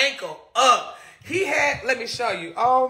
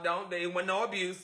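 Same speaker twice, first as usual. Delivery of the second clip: ordinary talk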